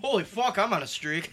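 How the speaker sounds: high, loud, thrilled outburst; gleeful yelp after a correct answer; giddy winning streak. Real, not acted. Trivia answer right, celebrating.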